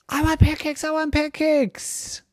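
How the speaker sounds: high-pitched clamoring for food; demanding kids. A narrator's reading. Four boys rushing home to parents, excited demand.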